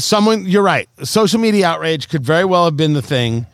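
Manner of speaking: ordinary talk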